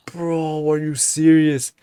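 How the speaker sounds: high, drawn-out disbelief; incredulous protest. Retold or imitated. Acted protest to teacher.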